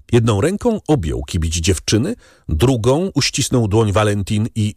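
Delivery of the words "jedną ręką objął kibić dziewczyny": usual speaking voice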